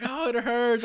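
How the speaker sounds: high mocking cry of pain; mock scream. Son imitates mom crying out at the ice pack, high mock wail. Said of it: "screams like".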